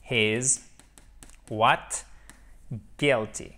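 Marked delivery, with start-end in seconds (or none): none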